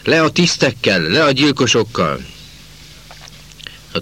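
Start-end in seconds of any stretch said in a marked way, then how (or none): 0.0-2.2 high, raised-voice street chant; yelled slogan. A narrator's reading. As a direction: shout it out from the crowd, pitched high. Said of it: "elordította magát". (felkiáltás magas, emelt hangon)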